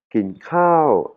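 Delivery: "Kin kao" with "kao" jumping up high, then falling; rising high tone. Shown as a demonstration. Teacher models the phrase for students, high tone on "kao". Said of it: "un son montant aigu".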